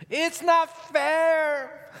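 put-on very high, loud, very slow kid wail; drawn-out high child's protest; whiny kid. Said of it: "that's all I ever hear from my kids".

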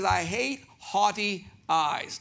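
"haughty" said slowly as HAW-tee, not like "hot"; careful haughty. Shown as a demonstration. Pastor spelling out the word for the congregation, slow and deliberate. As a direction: draw out "haughty eyes" very slowly. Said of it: "It's hard to say with a Southern accent".

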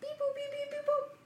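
high, soft beep-boop dial tones; phone dialing beeps. Pretend. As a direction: chirp the keypad beeps like a phone. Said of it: "I need to make a call".

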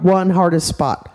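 ordinary delivery, nothing marked

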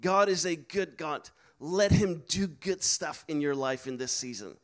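ordinary talk

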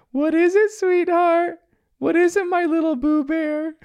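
loud, very high falsetto; dad doing a hammed-up impression of mom, sing-out and sugary; Chili, awful mimicry. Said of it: "in his falsetto, trying to act like Chili".

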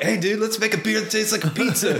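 high, laughing stoner dude. Retold or imitated. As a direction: giggle it like a stoned brewer. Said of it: "imagine them smoking a lot of weed".

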